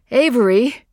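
loud high call across the clearing. Acted. Mother calls to the girl, loud worried call. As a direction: call her name urgently.

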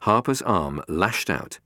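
plain reading voice

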